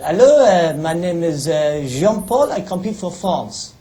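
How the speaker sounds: slow, hammed-up French accent. Frenchman fooling the security guard, slow hammed-up French accent. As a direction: lay on a mock French accent, drawing out Jean-Paul. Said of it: "pretend I am a French athlete".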